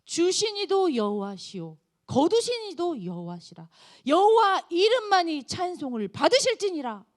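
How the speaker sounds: slow, reverent scripture recital; solemn confession. Acted. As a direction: intone it as a vow. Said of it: "그러면서 고백합니다". (느리고 경건한 신앙 고백)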